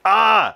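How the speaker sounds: high, loud "ah!"; re-enacted fan squeal. Viewer reacting as story moves on, loud mock squeal. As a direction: let out a frustrated-fan cry. Retelling own reaction to show.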